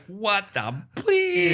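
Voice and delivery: high, drawn-out mock disbelief; comic high-pitched bewilderment; shocked viewer reaction. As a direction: stretch it out high, aghast.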